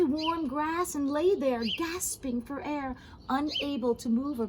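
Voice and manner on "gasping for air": ordinary delivery, nothing marked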